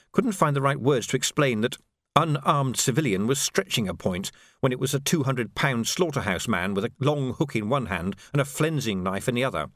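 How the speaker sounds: usual speaking voice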